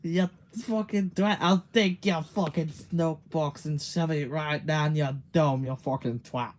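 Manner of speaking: loud mock-angry Scottish accent; Scottish rant. Streamer clowning as a Scot, loud Scottish rant. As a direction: hurl it out in a thick Scottish accent. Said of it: "Hell no, I'm Scottish".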